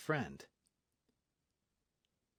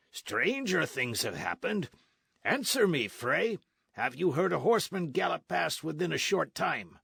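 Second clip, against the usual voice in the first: higher, pushy questioning; demanding sergeant; 7 semitones higher, 4 dB louder than usual. Acted. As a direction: press him for an answer.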